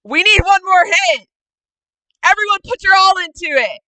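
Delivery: high, very loud battle cry; rallying shout. Acted. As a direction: shout to rally everyone for one last push. Hero rallies allies for final hit.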